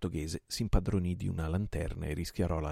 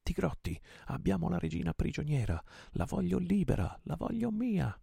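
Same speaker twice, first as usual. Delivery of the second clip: much higher, building passionate call; rallying cry; 11 semitones higher than usual. A narrator's reading. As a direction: whip the crew into fury. Rallying crew for the queen.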